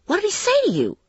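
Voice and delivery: high-pitched avid question; excited, wide-eyed curiosity; eager amazement. Acted. Friend eagerly asks after encounter.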